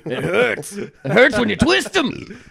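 high, loud, drawn-out mock wail; hammed-up pained yelp; Mel Gibson. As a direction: imitate a cartoon actor crying out. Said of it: "anytime Mel Gibson's there".

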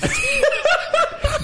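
high, helpless laughter; laughing. Real, not acted. Storyteller told off for the accent, high laughter.

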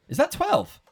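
thrilled to reach twelve; excited check. Real, not acted. Host thrilled the family reached twelve, excited.

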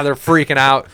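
ordinary delivery

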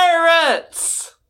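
high call from the lookout. Acted. Eagle Eye calls down from above, high warning shout. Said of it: "Eagle Eye calling from above".

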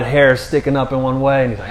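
normal talking voice

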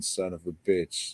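ordinary talk, nothing marked

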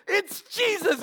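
high-pitched acted cry of recognition; excited exclamation. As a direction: blurt out a thrilling discovery. Retold John's sudden recognition.

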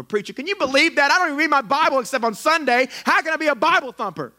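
high, loud whiny complainer; hammed-up indignant church member; whining churchgoer. Mocking a churchgoer complaining to the preacher, high whine.

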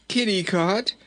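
high, pleading call; distressed cry. A narrator's reading. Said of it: "cried poor Rinkitink".

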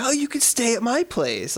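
mock over-friendly high voice; gushing friendly stranger. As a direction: play her as sweetly over-welcoming. Voicing the overly friendly film character.

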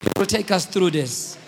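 rally the congregation with ringing conviction; full-force preaching. Real, not acted.

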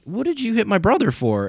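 high, indignant demand; older brother squaring up to the bully, voice pitched high; confronting the bully. Retold or imitated. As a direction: go at the kid for hitting your brother. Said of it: "I'm like".

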